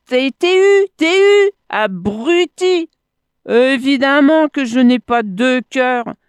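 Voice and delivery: high, loud taunt; scornful yelling. A narrator's reading. Monkey tells the crocodile he was fooled, scornful yelling. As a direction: hammer the insult out high and loud.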